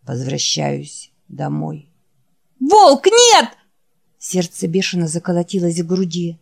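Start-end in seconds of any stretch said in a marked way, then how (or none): 0.0-1.9 low, soft, worn-out creature voice; barely has the strength to say the name; weary dying Wolf. Acted. Wounded Wolf calling his friend Jack, low and faint. Wolf's voice. 2.6-3.5 very high, loud cry of the name; shout. Acted. Jack crying out as Wolf disappears, very high loud shout.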